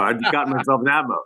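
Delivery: ordinary talk, nothing marked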